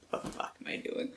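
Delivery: soft unvoiced self-mockery; whispered aside. Real, not acted. Booktuber mocking herself mid-reading, whispering. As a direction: whisper it to yourself.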